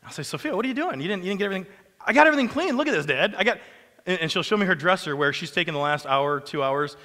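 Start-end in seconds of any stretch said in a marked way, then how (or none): 0.2-1.7 puzzled dad. Retold or imitated. Dad questioning daughter. 2.0-3.6 put-on proud little girl. Daughter voiced proudly.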